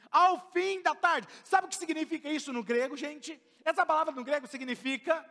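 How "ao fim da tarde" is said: Ao fim da tarde drawn out slowly with a laugh; slow, laughing reading. Shown as a demonstration. Pastor rereads the verse to the church, slow and laughing. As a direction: stretch Ao fim da tarde out, chuckling.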